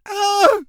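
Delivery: high shrill shriek; horrified scream. Acted. Serena screams as she flees in horror. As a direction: scream and flee. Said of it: "Serena screamed".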